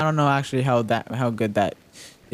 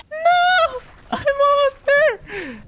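much higher, louder, slow strained cry; panicked squeal; 4 dB louder, slower than usual. Real, not acted. Gamer racing against the storm, squealing in panic. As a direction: strain every word to make it.

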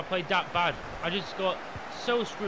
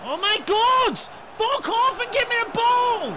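high, loud enraged yelling; furious shout; 9 dB louder than usual. Real, not acted. Gamer raging at his player, furious shouting. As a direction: scream at the screen in fury.

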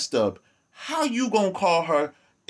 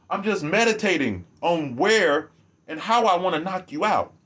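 normal talking voice

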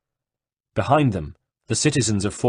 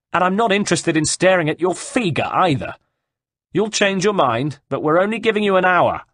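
high, raised, contemptuous ultimatum; threatening shout; 6 semitones higher, 4 dB louder than usual. A narrator's reading. Cecco gives Caterina an hour before riding off, threatening shout. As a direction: hammer the threats home across the distance.

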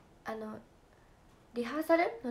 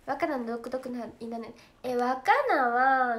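slowed, drawn-out mock of Wakana; slow imitation of Wakana; 6 dB louder, much slower than usual. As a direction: drag each word out lazily, teasing.

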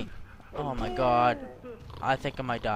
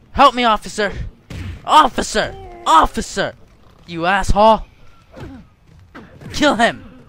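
loud, higher calls for the officer; calling out; 5 semitones higher, 9 dB louder than usual. Real, not acted. Gamer in a fight calling the guard, loud higher shouts. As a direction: shout for the guard to come help.

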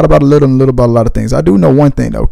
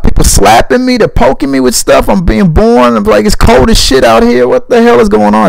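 mock screaming newborn; 8 semitones higher than usual. Vlogger voicing a newborn just out of the womb, high indignant squeal. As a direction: play a newborn shrieking at the cold world.

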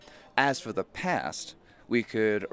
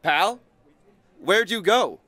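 loud, high pleading shout; desperate calling out; 5 semitones higher, 5 dB louder than usual. Acted. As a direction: beg someone who left to come back, building to a cry. Desperate plea after someone leaving.

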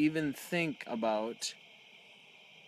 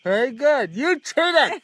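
excited; 9 semitones higher, 8 dB louder, slower than usual. Acted. Mock outrage at cheating.

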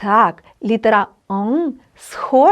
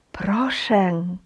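"Прошен" whispered, unvoiced and soft; whispered echo; 5 semitones lower, 8 dB quieter than usual. Shown as a demonstration. Teacher whispering the word as an echo for students. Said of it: "читаймо слова".

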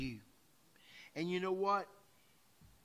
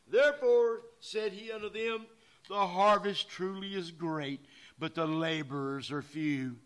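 preaching scripture; 8 dB louder than usual. Acted. Preacher reading the harvest verse to his church, loud proclaiming. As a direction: proclaim the call for laborers.

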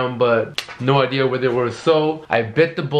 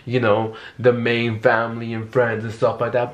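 normal talking voice

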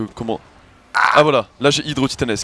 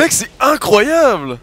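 amazed; 10 semitones higher, 6 dB louder, much slower than usual. Real, not acted. Amazed at teammate's play.

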